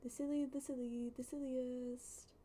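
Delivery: usual speaking voice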